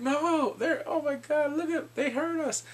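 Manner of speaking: panicked whine. Retold or imitated. Husband complaining about the babies, high panicked whine. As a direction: whine like a bullied babysitter. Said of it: "He's like".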